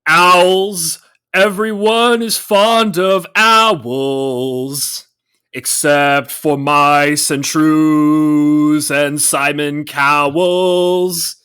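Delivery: slow, loud deadpan recitation; drawn out like a cartoon narrator; slow song narrator voice. Quoting the owl music video.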